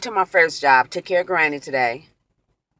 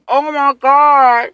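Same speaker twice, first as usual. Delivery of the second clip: loud, high, very drawn-out wail; slow, raised retold cry of shock; horrified outcry; 7 semitones higher, 8 dB louder, much slower than usual. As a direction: re-enact appalled disbelief, stretching it. Acts out her horror afterwards.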